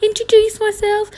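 very high, singsong hello to a neighbour; hammed-up polite introduction; over-friendly greeting. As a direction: pitch it way up, loud and eager to please. Re-enacts greeting her new neighbour.

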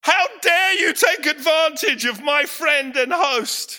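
loud, high indignant outburst; inner outrage. Retold or imitated. Preacher voicing his silent fury at the shop lady. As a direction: let the outrage boil over.

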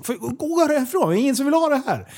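exasperated telling-off. Retold or imitated. Retelling telling the troublemaker to leave the shop, exasperated. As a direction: act out a fed-up plea to go away.

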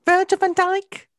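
high, slow drawn-out Scouse; Scouse accent. Pretend. Listing Liverpool players in a slow Scouse voice. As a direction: stretch the name in a high Scouse voice. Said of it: "pretty good Scouse accent".

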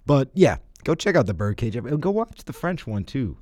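ordinary talk

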